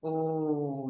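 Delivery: ordinary delivery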